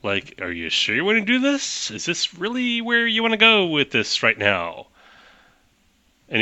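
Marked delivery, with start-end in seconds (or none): 0.4-4.9 high-pitched, sceptical are-you-sure voice; doubtful warning. Acted. Game master voices the cop's doubting look, high-pitched and sceptical. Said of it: "gives you a look like".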